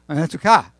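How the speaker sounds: Umetuka with the weight on the final -ka; stress on the last syllable. Shown as a demonstration. Pastor corrects his Hebrew for the congregation, last-syllable stress. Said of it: "no, last syllable".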